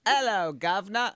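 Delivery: London accent. Shown as a demonstration. Demonstrating a go-to phrase for a co-host, London accent. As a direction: do a practised stage-London hello. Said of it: "get into my London accent".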